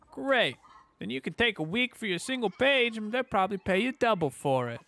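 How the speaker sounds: high-pitched mock Italian; lively, badly faked Italian accent; poor Italian accent. Player voicing a character ribbing the journeyman, poor Italian accent. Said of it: "try to do a poor Italian accent".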